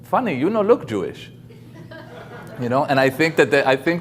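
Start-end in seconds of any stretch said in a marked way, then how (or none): 0.0-1.3 a self-consciously bad mock Chinese accent, pitched up high; clumsy joking Chinese accent for the punchline; poor Chinese accent. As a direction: deliver the punchline in an apologetic, hammed-up Chinese accent. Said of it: "very poor attempt at a Chinese accent".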